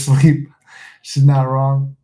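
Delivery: ordinary delivery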